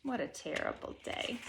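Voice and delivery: breathy whisper of defeat; hushed, defeated whisper; whispered sigh. A narrator's reading. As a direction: whisper it to yourself, worn out. Gloomy aside after bad lunch.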